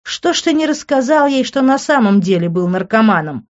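high, emphatic, biting taunt; venomous. A narrator's reading. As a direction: needle them with poisonous sarcasm. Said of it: "ядовито спросил Тео".

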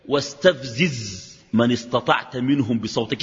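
slow, measured chanted recitation of واستفزز; slow Quran recitation. Acted. Preacher quoting the Quran in a slow recitation. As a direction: recite the verse slowly and solemnly.

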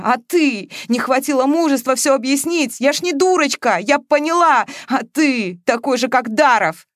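heated, high, loud reproach; sharp accusation. A narrator's reading. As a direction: hurl it at him in a quarrel. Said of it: "резко отвечала Маша".

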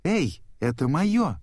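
high, drawn-out protest; indignant yell. A narrator's reading. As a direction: yell it in a high voice, stretching the words. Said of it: "завопила Дори".

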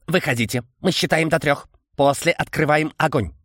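strange clicking insect voice; chittering insect creature. Acted. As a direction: voice a buzzing bug-man. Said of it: "Какой же странный голос, стрекочущий".

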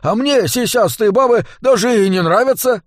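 high, blustering excuse; defensive dwarf. A narrator's reading. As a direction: squeak out a denial. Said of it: "защищался Дворф".

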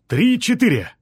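loud, high strained shout on the lift; shouted count. A narrator's reading. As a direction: yell the count while heaving. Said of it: "крикнул Артём".

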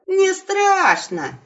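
high, slow cheeky yell; defiant shout. Acted. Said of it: "выкрикнул в ответ Серый". (дерзкий выкрик в ответ)

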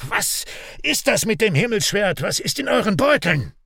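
high-pitched, furious demand; full of anger. A narrator's reading. Raik flinging questions at Vahan, full of anger. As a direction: hurl the questions angrily. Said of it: "schleudert Raik Vahan voller Zorn entgegen".

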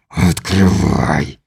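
low, slow menacing bark; barking alien. A narrator's reading. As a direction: growl the order like a beast. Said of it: "рявкнул чужак". (низкий медленный рявк)

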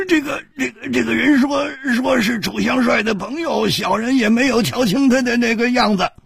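high, wailing voice of a frightened brute; wincing, miserable. A narrator's reading. Scared big man pleads with Chu Liuxiang, high and whimpering. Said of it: "苦着脸说".

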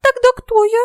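very high, squeaky amazement; stunned little fairy; astonished fairy. A narrator's reading. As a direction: ask in a tiny baffled fairy voice. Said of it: "изумлённо спросила Ульрика".

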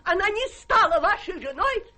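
high, laughing triumphant announcement; gleeful laughing reveal. Acted. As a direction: crow it out with laughter.

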